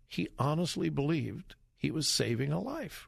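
ordinary talk, nothing marked

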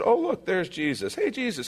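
lightly sent-up cheery call; mock casual greeting. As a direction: greet him as if nothing is odd.

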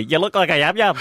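high mock Birmingham accent; Brummie. Host listing accents for listeners, mimicked Brummie. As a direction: put on Billy's Brummie twang. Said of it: "The Brummie accent".

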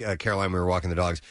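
usual speaking voice

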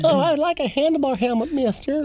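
very high, put-on kiddie voice; pretend eager child. Student asking the teacher for a braid style, mock-childlike. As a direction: squeak it out like a hammed-up little pupil.

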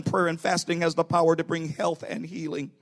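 ordinary delivery

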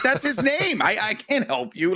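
high-pitched retold protest; acted exasperation; exasperated defence. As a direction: plead helplessly, pitch way up. Retells his exasperated answer to listeners.

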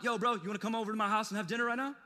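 rushed, breezy bro invitation; mock overeager invite. Preacher imitating a pushy stranger, fast bro talk. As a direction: fire it off fast and casual. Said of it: "I usually am not like".

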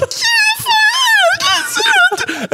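very high, crying shout; screaming in terror. Retold or imitated. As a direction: shriek it like a panicked kid. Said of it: "And I'm like".

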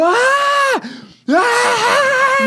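high-pitched drawn-out scream, acted out; retold scream. Telling podcast co-host how they started screaming in the shower, high scream. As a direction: re-enact your own horrified "Ah!". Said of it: "eu comecei a gritar".

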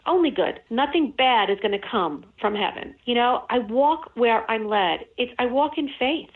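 ordinary talk, nothing marked

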